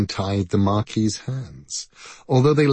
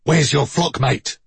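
mildly barked, higher-pitched demand; sharp bark, raised in pitch; barked question; 4 semitones higher, 4 dB louder than usual. A narrator's reading. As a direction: bark it out like a guard creature. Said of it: "A voice barked".

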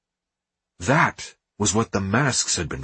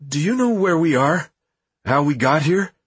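high, trembling and lost; shaky voice; 6 semitones higher, 3 dB louder than usual. A narrator's reading. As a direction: ask with a nervous tremble. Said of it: "in a shaky voice".

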